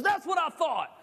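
put-on high, smug little boy; smug kid voice. Small kid gloating at the big guy, high and smug. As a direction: gloat like a tiny winner.

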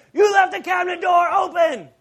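high, loud, angry shout; retold yell. Husband yelling at his wife about the cabinet, angry shout. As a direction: yell it at your wife like you just hit your head. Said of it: "and I'd yell at Perry".

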